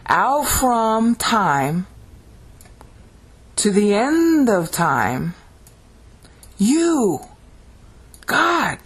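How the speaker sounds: high, very drawn-out words with big pauses; dramatic proclamation. Shown as a demonstration. Teacher rendering the literal Hebrew in English, dramatic. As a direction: proclaim each word slowly, climbing to a peak. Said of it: "what the Hebrews here are saying".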